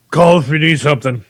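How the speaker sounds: talking through a mouthful; words muffled round a bite of food; mouth full of muffin. Acted. Ket calling to friends mid-muffin, mouth full. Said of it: "with, like, half this meat muffin in his mouth".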